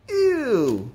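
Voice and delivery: high, falling ew; disgusted cry. A narrator's reading. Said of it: "he cried".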